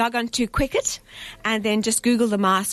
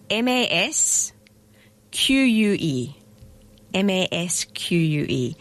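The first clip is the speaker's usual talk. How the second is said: spelling it out. Shown as a demonstration. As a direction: carefully spell the name out letter by letter. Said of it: "mask is a strange spelling".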